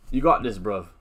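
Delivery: lightly put-on, bad London accent. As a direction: attempt a London accent, badly. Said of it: "That's a terrible London accent".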